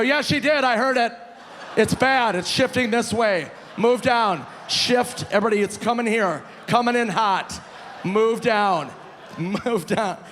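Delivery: hammed-up urgent warning; mock alarm. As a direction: clear the bar as if in an emergency. Mock-warns the crowded bar to move.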